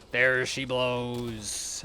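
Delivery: very slow, drawn-out pirate call. Acted. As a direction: call it out like a whaler spotting a whale. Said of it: "in a pirate voice".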